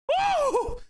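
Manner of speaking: shrill cheering whoop; high whoop. Real, not acted.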